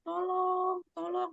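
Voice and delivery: high, slow, faint mock plea; plea for help. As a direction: lightly voice a drowning woman begging, softly.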